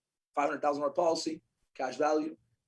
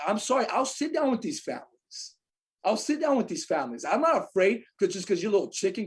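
indignant, higher-pitched rant; heated challenge; 4 semitones higher, 3 dB louder than usual. Real, not acted. Agent challenging colleagues afraid to face families, heated. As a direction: scold them for lacking nerve.

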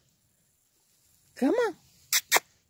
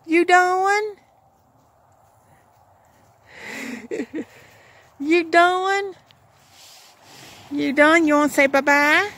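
higher, sing-song talk to a dog; 6 semitones higher than usual. Real, not acted. Coaxing her dog Jake to say bye-bye, sing-song baby talk.

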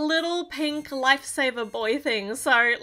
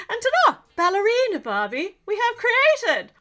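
hammed-up posh English accent, higher and louder; 8 semitones higher, 5 dB louder than usual. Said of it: "I put on a posh accent there".

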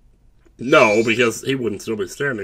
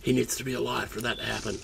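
hushed; 11 dB quieter than usual. Real, not acted. Whispered stealth planning.

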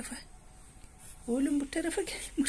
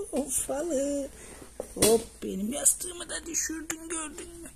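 high, louder playful scold; scolding the cat; 7 semitones higher, 3 dB louder, slower than usual. Real, not acted. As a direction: tease the cat for knocking it over. Kediye yüksek sesle sitem ediyor. (kediye ince sesle şakacı sitem)

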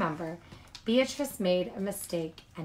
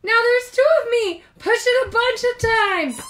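much higher and louder, thrilled; excited high Larry; 12 dB louder than usual. Acted. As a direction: squeal with delight at the change.

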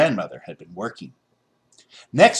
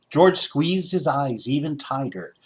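usual speaking voice

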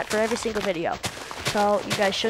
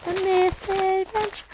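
mock title voice; 11 semitones higher than usual. Put-on title voice.

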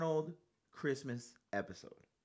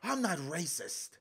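mocking the old man; 8 semitones higher, 3 dB louder than usual. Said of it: "And he goes".

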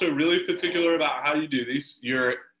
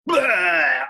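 put-on sudden loud baby-style bah, a burst; baby spit-up bah; 5 semitones higher, 8 dB louder than usual. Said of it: "she goes".

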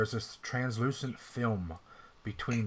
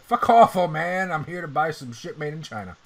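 loud, higher mock snap at the greeter; rude brush-off; 6 semitones higher, 10 dB louder than usual. Player brushing off the sad greeter, loud mock anger. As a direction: tell the old man off loudly. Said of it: "And you're like".

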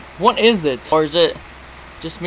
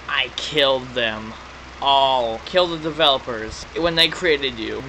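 low, slow villain voice; sinister, drawn-out confession; 3 semitones lower, slower than usual. Acted. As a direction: read it with slow villainous menace. Reads villain's confession in game.

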